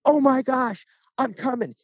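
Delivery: very high-pitched, thrilled exclamation; retold excitement. Hearing a stranger's turkey call, acted excitement. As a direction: blurt it out like an eager hunter.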